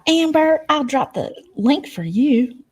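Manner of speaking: high, slow sing-song mock of Bob; drawn-out mocking lilt. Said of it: "she's like".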